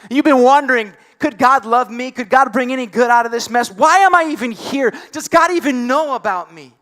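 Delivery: pressing, high-energy sermon voice; impassioned preaching. Real, not acted. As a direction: fire the doubts out like a preacher.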